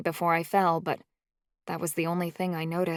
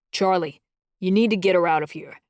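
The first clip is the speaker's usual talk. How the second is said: louder and fast, rough and urgent; rough urgent warning; 6 dB louder than usual. A narrator's reading. Chancey urging Charlie to get her out, rough and fast. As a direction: bark it out quickly, nervous of the guards. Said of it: "Chancey said roughly".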